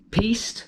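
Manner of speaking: "Peast" as one drawn-out syllable; "Peast", offered as a guess; tentative pronunciation. Shown as a demonstration. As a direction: try "Peast" slowly, unsure of the name. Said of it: "not really sure how to pronounce".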